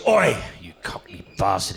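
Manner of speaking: rough, menacing call-out; hostile heckle. Acted. Welsh bloke confronts the London comedian, hostile heckle. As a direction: open on a barked challenge. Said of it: "he goes".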